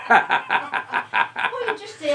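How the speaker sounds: a high laugh running into words. Real, not acted. Wife laughing as she coaches husband what to say. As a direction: giggle out the advice.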